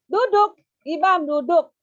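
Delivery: high, loud order to sit; teacher ordering a pupil to sit; firm command. Shown as a demonstration. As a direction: tell the child firmly to sit. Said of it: "kita pegang meja duduknya".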